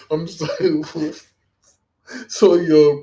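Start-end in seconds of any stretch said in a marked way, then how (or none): 0.1-1.1 sorry, said slowly through laughter; laughing apology. Real, not acted. Host apologizing to the guest while still laughing.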